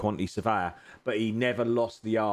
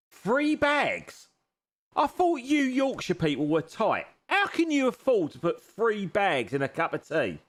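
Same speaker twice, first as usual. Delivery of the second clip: mock disbelief; 9 semitones higher than usual. Mocks Yorkshire viewer's three teabags.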